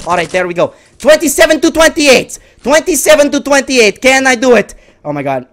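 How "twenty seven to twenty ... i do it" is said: high, loud score call; worked-up, urgent yelling; frantic excitement. Real, not acted. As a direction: shout it out with real tension. Calling a very close score.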